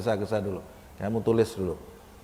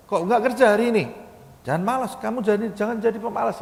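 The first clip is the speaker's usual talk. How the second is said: scolding uncle; 9 semitones higher, faster than usual. Retold or imitated. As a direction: nag him like a stern uncle pushing a lazy nephew. Uncle scolding his nephew.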